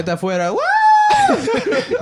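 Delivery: high, drawn-out laughing yell; party shout. Real, not acted. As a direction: whoop it up at the party.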